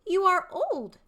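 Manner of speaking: high, loud, eager youth; young man's voice. Shown as a demonstration. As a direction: call out to the old father, high and loud. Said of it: "three distinct character voices".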